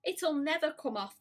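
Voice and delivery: high, cheerful voice; bright. A narrator's reading. Earthworm cheerfully predicting the centipede will never move, high and bright. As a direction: say it brightly, as if it were good news. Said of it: "the earthworm said brightly".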